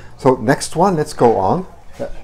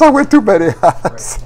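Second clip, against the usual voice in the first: laughing through the words; amused laughing admission; 6 semitones higher than usual. Real, not acted. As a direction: chuckle it out at yourself. Laughing at own auctioneer role.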